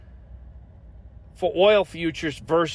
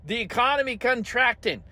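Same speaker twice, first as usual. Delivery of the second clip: higher, gushing mock delight; mocking Navarro; 5 semitones higher than usual. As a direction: ham up a cheerful spin doctor. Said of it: "he's like".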